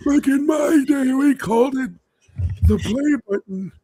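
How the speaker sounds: high, loud put-on old-timer voice, slowed; old war veteran. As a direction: ham up a crusty old vet remembering the war. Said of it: "rasp and, like, deepness to sound like you're from war".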